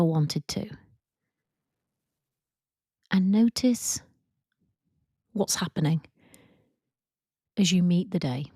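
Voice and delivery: calm, even guided meditation voice with long pauses; guided visualization. Acted. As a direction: ease them into imagining, leaving space after each prompt.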